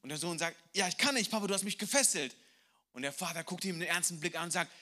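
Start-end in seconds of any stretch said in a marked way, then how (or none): 0.7-2.4 son's protest. Acted. Son protests to father.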